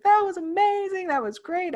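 high-pitched gushing praise; retold raving. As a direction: imitate an enthusiastic crowd. Group raving after the role play.

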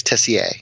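"Tessier" as TESS-ee-ay; common French way. Shown as a demonstration. Patron tells host his preferred way, "Tessier" ending in ay. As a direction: end "Tessier" on an open ay, no r. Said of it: "the more common French way of saying it".

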